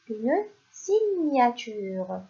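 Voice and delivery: une signature stretched out, each syllable clear; slow sounding-out; slow dictation. Shown as a demonstration. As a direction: say une signature slowly for young pupils. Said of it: "teacher dictates word slowly".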